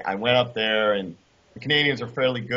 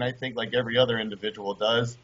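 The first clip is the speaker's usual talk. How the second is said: ordinary delivery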